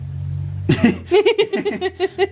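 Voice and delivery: high giggle; high laugh. Real, not acted. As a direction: laugh it off lightly. Laughs at correction.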